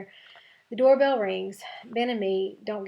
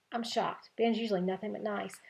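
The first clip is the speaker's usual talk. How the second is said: plain reading voice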